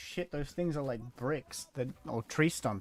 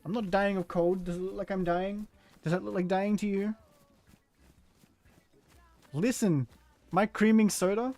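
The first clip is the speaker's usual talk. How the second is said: mock indignant; 8 semitones higher, 6 dB louder than usual. Mock-indignant reply to chat.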